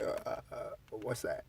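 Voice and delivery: breathless unsure whisper; hesitant whispered Pedro. Retold or imitated. Pedro asking the narrator about Molly, confused whisper. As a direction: play timid and confused.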